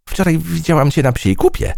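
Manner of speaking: high, buzzy little fly voice. A narrator's reading. Fly teasing the proud butterfly, high and chirpy. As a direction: squeak it out like a gossipy fly. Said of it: "powiedziała mucha".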